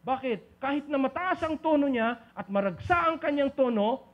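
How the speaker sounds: usual speaking voice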